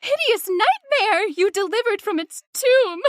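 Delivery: ordinary talk, nothing marked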